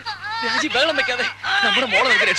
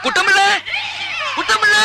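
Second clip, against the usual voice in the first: urgent shout; 6 semitones higher than usual. Acted. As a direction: yell for the servant in panic. Father shouting for the servant. (പരിഭ്രാന്തിയോടെ ഉറക്കെ വിളിക്കുന്നു)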